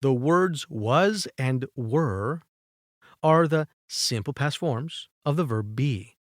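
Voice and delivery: very slow, careful English reading; slow rule reading. Shown as a demonstration. Teacher reading a grammar rule to students, very slow. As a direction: read the rule out slowly for learners. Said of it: "Este verbo de ser".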